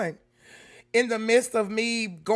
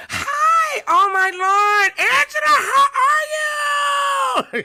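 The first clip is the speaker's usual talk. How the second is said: excited squeal; 7 dB louder, much slower than usual. Real, not acted. Greeting viewers joining the live.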